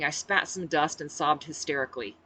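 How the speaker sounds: ordinary delivery, nothing marked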